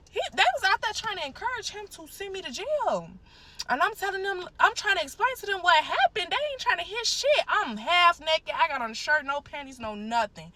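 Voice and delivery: high, loud, agitated retelling; worked up. Real, not acted. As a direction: pour it out in rising outrage. Worked up retelling of her arrest.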